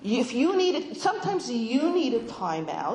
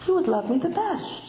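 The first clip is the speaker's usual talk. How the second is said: higher, lightly voiced little girl; a hint of wide-eyed child; little sister-in-law; 6 semitones higher than usual. Little girl to her new sister-in-law, lightly childlike. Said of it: "she said, and opened her big brown eyes".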